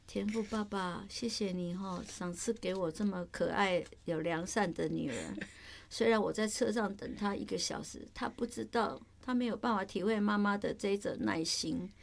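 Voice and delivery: soft, subdued prayer to a loving Father; demonstrated prayer. Shown as a demonstration. Mother prays aloud beside her late daughter, soft and patient. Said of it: "你的祷告词是什么？".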